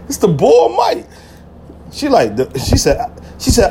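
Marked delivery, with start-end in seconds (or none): 0.1-1.1 "It's the Bull Mike" said slow and higher, leaning on "Mike"; proud nickname. Retold or imitated. Introducing himself on the phone, slow and higher with pride. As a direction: announce "Bull Mike" slowly, up high, proudly.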